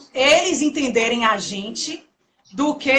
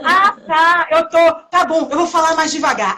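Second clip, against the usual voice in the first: higher, louder, hurried mock agreement; flustered local man; 4 semitones higher, 4 dB louder than usual. Portuguese man caught shouting, hurried loud agreement. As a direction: play the abashed man quickly agreeing.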